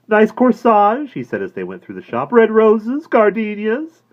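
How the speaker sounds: high, slow, sugary praise; oily salesman. A narrator's reading. Florist praising her corsage, high and slow. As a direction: flatter to sell.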